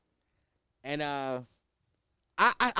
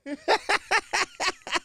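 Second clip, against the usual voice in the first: high-pitched staccato laugh; real laugh. Real, not acted. Host cracking up after saying bucko, high laugh. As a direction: laugh in quick high bursts.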